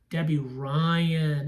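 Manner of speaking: "Debbie Ryan" drawn out slowly; slow repeat. Real, not acted. Reviewer slowly repeating the actress's name she just recalled.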